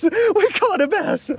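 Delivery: high, drawn-out happy cry; excited. Real, not acted. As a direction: stretch it out with delight. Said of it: "But we caught a bass".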